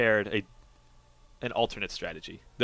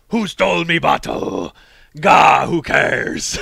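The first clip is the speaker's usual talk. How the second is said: high, slow growling pirate shout; 5 semitones higher, 12 dB louder, much slower than usual. Pretend. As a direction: bellow it like a drunk pirate captain. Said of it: "That's a pretty good pirate voice".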